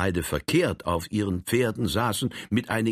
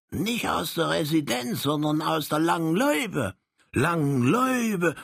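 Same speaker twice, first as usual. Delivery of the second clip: a touch higher, curious; lightly raised Frank voice; 9 semitones higher than usual. Acted. Frank asks the little Saxon, lightly higher voice.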